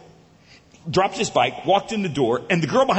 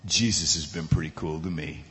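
deep, quiet, understated reply; big guy, humble; 12 semitones lower, 6 dB quieter than usual. Retold or imitated. Big guy answering the counter girl, low and humble. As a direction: say it very low and softly. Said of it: "the big guy said".

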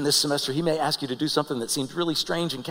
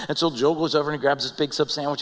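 ordinary delivery

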